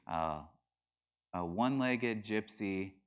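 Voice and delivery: usual speaking voice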